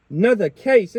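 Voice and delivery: usual speaking voice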